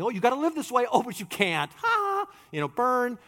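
sing-song taunting with a shrill fake laugh; hammed-up mocking ha ha ha. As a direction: tease cruelly, then cackle. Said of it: "dangling this thing and saying".

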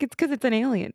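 high, sarcastic quip. Retold or imitated. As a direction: point out the obvious dryly.

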